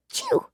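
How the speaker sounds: a high, squeaky little sneeze. Acted. Slime pet sneezing at Piper, high sneeze. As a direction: burst out a tiny high sneeze. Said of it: "The slime pet sneezed".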